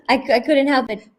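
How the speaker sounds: embarrassed laughing words. Real, not acted. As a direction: say it through a giggle.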